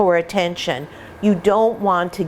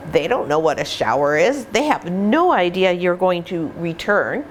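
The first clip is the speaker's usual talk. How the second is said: normal talking voice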